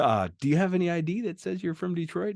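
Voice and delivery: high-pitched clerk imitation; counter clerk. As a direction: put on a high, questioning desk-worker voice. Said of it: "And they're like".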